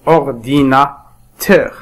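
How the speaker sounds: "Ordinateur" slowly, a gap after each syllable. Shown as a demonstration. Teacher splits the word for learners, syllable by syllable. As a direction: separate every syllable of "Ordinateur". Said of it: "نقسم هذه الكلمات إلى مقاطع".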